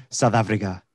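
attempted South African vowels; South African accent. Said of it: "Is that how South Africans sound?".

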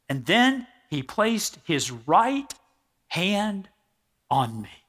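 hushed scripture reading. Acted. Preacher reading the gentle touch to the congregation, slow and soft. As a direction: linger on the touch, softly.

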